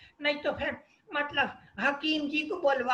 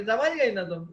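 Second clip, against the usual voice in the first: ordinary delivery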